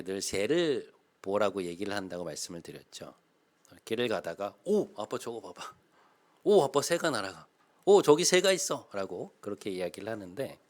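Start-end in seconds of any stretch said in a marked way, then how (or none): 4.6-8.9 imitating excited child. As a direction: kid calling Dad to look, getting louder and higher. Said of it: "이런 톤으로 이야기하거든요". (아이처럼 들뜬 목소리로 흉내)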